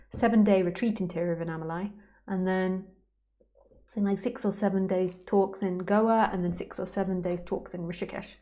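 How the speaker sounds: ordinary delivery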